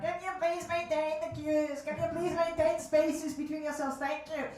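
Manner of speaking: flat nasal queue announcement; nasal loudspeaker voice. Retold or imitated. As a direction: announce the queue rules like a cheap megaphone. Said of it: "a little loudspeaker ... make my voice more nasal".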